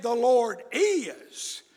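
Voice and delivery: IS drawn out long and slow; emphatic IS. Real, not acted. Preacher contrasting it with may and might, emphatic stretched IS. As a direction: lean slowly on the word is. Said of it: "It is emphatic".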